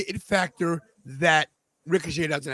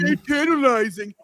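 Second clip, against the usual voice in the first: mocking imitation; 5 semitones higher than usual. Showing how she mocks him, mocking imitation. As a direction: parrot the catchphrase teasingly. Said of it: "She mocks me".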